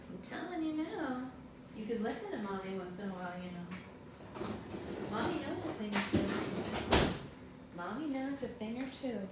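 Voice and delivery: soft, lilting parent voice; gentle coaxing. Real, not acted. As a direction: coax a little one softly toward a nap. Parent coaxing child toward a nap.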